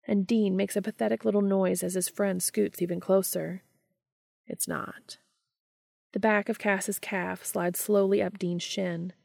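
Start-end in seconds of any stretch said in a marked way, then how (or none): 4.5-5.2 soft, breathy hush; whisper. Acted. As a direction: murmur it close to his ear. Castiel softly comforting Dean.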